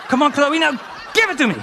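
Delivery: high, loud urging; eager urging. Acted. Comic urging the video on, high and loud. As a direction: beg the screen to hurry.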